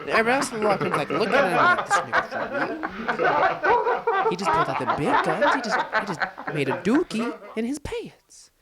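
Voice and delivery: high-pitched, breaking into laughter; laughing while talking. Real, not acted. Storyteller cracking up over the locker room reaction, laughing hard. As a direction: tell it through real laughter.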